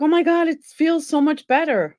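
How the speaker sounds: thrilled to wake up healed; excited relief. Retold or imitated. Waking up pain-free, delighted surprise. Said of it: "I was like".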